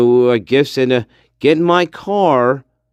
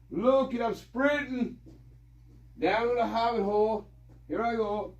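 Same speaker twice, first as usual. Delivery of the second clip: calling from off-mic; 6 semitones higher, 12 dB quieter than usual. Real, not acted. Telling the guys he is sprinting down to the hobbit hole, calling from afar. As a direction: call back from across the yard as you head off. Calling back while heading outside.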